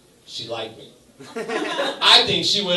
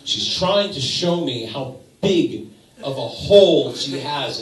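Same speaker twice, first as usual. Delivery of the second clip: normal talking voice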